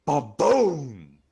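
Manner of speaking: loud booming blast; cannon boom. A narrator's reading.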